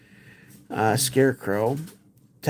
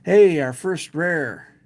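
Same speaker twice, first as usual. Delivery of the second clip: excited; 5 semitones higher, 6 dB louder, slower than usual. Real, not acted. First rare card opened.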